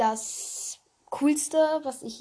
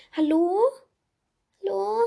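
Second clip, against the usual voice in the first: higher, louder rising call into the empty shop; 10 semitones higher, 5 dB louder than usual. A narrator's reading. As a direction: call out to find someone. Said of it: "rief Raven".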